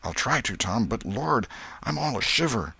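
mildly fearful confession; a touch of trembly dread; frightened boy. A narrator's reading. Huck admits he is shivering.

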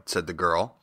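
ordinary delivery

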